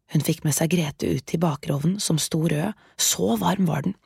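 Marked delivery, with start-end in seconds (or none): none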